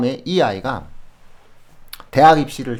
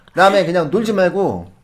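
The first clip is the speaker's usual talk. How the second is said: higher, louder mock scolding; mock parent command; 6 semitones higher, 6 dB louder than usual. Mocking how parents order kids to study, higher and louder. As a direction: play a parent ordering the kid to sit at the desk. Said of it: "이렇게 생각하지 마세요".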